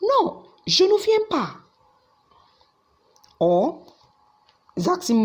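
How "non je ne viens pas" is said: high, emphatic refusal on a call; emphatic no on the phone. Shown as a demonstration. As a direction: answer the caller with a firm, high no. Somebody calls you on your phone.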